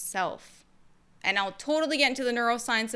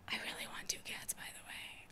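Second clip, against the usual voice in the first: fast confiding whisper; whispered aside; 17 dB quieter, faster than usual. Real, not acted. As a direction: lean in and whisper it as a secret.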